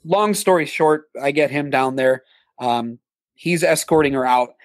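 ordinary delivery

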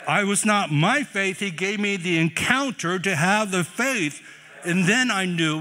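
loud, high, slow sermon climax; shouting praise, then slowing right down; full-force preaching. Real, not acted. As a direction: drive it home to the congregation.

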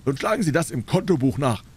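hohe, auftrumpfende Händlerstimme; triumphierender Händler. A narrator's reading. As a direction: den Kunden herausfordernd zurechtweisen. Dealer challenges Holmes in story. (auftrumpfender Geflügelhändler)

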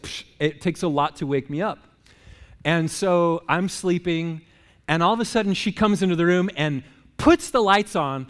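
ordinary delivery

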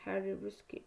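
usual speaking voice

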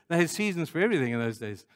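ordinary talk, nothing marked

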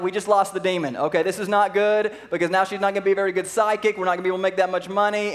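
loud, high, fast complaint; angry masters. Retold or imitated. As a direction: voice the slave owners ranting loudly. Masters angry at losing income.

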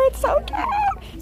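high, slow squeal of delight; drawn-out high "so cute" squeal. Real, not acted. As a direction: coo it like she's melting. Finds item she long wanted.

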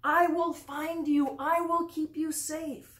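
shepherd calling to the lost lamb. Acted. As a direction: call out across the dark hillside. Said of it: "the shepherd called out".

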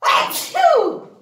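high hammed-up sneeze; fake sneeze. Teacher pretending to sneeze for the class, hammed-up. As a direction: play-act a big sneeze. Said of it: "Bless me".

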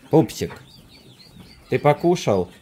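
high cooing pet talk. Real, not acted.

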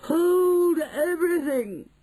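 long, high, loud witch's shriek. Acted. Witch halts the departing room, drawn-out shriek. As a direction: shriek it out slowly like a trumpet. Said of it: "Her shrieking voice echoed".

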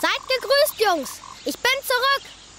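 put-on proud high call to the boys; cocky greeting. Peter crowing hello to the lost boys on his return.

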